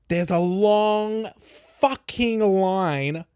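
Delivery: very slow, stretched-out words; drawn-out emphasis. Retold or imitated. Storyteller reveals the huge queue, slow and emphatic. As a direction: drag out every word for emphasis.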